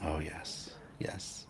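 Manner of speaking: a soft breathy whisper, barely voiced; whispered aside. Real, not acted. Host confessing to co-host, in a whisper. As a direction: drop to a whisper as if admitting something.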